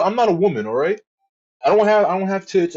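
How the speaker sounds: ordinary talk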